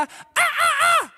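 very high, piercing squawks; imitated bird screech. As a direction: mimic the screaming birds. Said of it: "jeg elsker å høre fuglene skrike". (etterlignede, skingrende fugleskrik)

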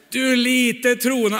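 slow, loud rebuke; stern scolding. Acted. Jesus scolding Peter on the water, slow and loud. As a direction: give him a sharp telling-off.

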